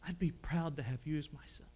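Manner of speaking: tearful, choked up. Retold or imitated. Brother Jack to young Paul, choked up and soft. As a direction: retell a crying man's words gently. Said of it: "he's crying".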